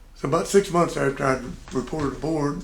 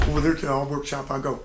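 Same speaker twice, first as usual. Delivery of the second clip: ordinary delivery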